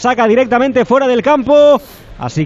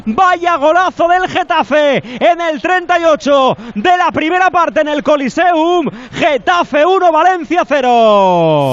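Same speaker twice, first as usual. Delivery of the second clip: higher, slow, excited goal recap; excited commentary; 6 semitones higher, slower than usual. Real, not acted. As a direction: relive the goal, still buzzing. Goal replay description.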